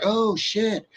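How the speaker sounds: lightly mimicked girl caught out; quiet, high oops reaction; imitated dismay. Imitating girl realizing her mistake.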